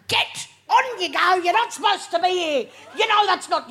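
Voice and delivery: mocking a suspicious local shooing her off; suspicious bloke. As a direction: shoo away a supposed intruder. Suspicious guy at the lockbox.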